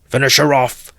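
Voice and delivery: high, loud furious yell; savage shout. A narrator's reading. Mikolka at the fallen mare, savage shout. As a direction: roar for the kill. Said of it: "shouted Mikolka".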